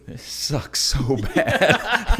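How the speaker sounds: loud, slow, laughing complaint; laughing at how bad the race feels; laughing misery. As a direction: drag it out through laughter.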